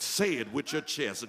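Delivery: ordinary delivery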